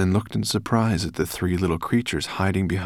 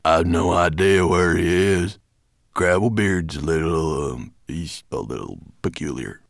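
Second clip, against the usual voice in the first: dwarf Stonebeard; 3 dB louder than usual. A narrator's reading. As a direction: explain haltingly as a mountain dwarf. Stonebeard answers as gruff dwarf.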